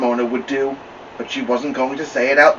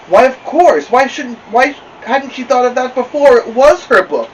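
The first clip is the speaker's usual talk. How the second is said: much higher and louder, excited; delighted realization; 12 semitones higher, 9 dB louder than usual. Acted. Girl realizing the book is hers, excited and loud. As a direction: burst out with the happy realization.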